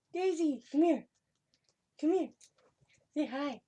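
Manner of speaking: high, loud call to a pet; calling the cat. Real, not acted. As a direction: call the cat over sweetly. Calling her cat over.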